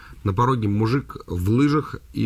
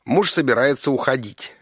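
normal talking voice

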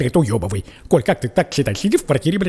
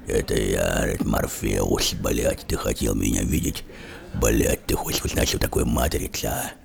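deep booming bass, much lower and slower; Karachentsov voice; 6 dB quieter than usual. Retold or imitated. Morpheus phones Neo and announces himself in a deep bass voice. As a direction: rumble it low like a grave movie mentor. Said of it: "голос такой, знаешь, как у Коли Караченцева".

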